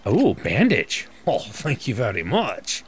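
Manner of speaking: hammed-up French chef thanking; mock French accent, slow and pleased; a comic French chef voice. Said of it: "talking like a French chef".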